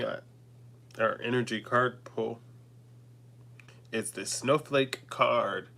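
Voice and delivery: ordinary delivery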